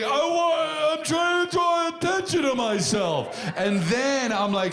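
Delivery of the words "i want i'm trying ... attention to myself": very high, hammed-up yelling; mock heckler yell. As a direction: mimic the heckler bellowing for attention, pitched way up. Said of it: "so goddamn polite at the end".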